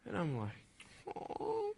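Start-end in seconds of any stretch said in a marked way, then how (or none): 1.1-1.7 very high, soft "aw"; touched "aw". Retold or imitated. Boy reacting to a girl's compliment, high soft aw. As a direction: coo it softly, way up high.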